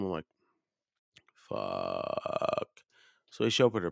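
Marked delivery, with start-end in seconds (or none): 1.5-2.7 long, low drawn-out groan; dismayed groan. Retold or imitated. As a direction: let it sink low and drag on in dismay. Dreading reply on being driven home.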